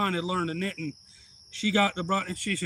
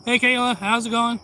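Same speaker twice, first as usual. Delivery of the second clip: loud, high called-out hello; hearty greeting; 7 semitones higher, 8 dB louder than usual. Retold or imitated. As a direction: imitate a visitor hailing everyone as he walks in. Visiting uncle calls out greeting.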